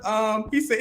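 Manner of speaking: ordinary talk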